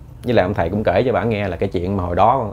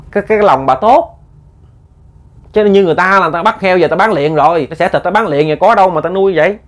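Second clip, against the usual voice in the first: heated insistence; 7 semitones higher, 5 dB louder than usual. Real, not acted. Teller insists, heated aside.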